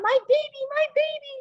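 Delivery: high, loud panicked mother cry; frantic mama llama. Retold or imitated. Teacher voices mama llama running to her baby, high and panicked. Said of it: "She's running around".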